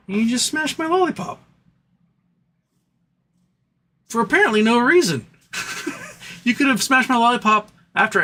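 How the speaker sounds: comic venting rant. Real, not acted. Streamer venting to chat about a stolen lollipop, aggrieved rant. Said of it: "Let the venting proceed".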